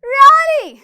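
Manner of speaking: loud, high, indignant challenge; angry Really. Shown as a demonstration. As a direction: demand it, hands on hips. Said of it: "fifth dialogue बोलेंगे". (गुस्से में "Really?")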